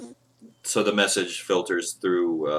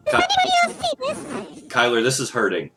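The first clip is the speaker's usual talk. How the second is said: pained cry; 3 dB louder, much slower than usual. Acted. DM voices Kyler in pain.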